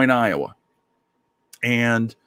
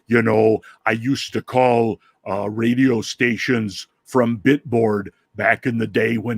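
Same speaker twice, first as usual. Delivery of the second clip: Jesse Ventura. Acted. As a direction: stretch the words out like a blustery ex-wrestler. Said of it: "wrestler that I can do very, very well".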